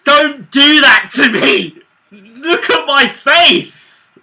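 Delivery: high, slow, furious shout. Real, not acted. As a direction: yell at him to stop. Yelling at teasing mate.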